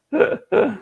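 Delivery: a real burst of high laughter. Real, not acted. Host reacting to a viewer's comment, real laugh.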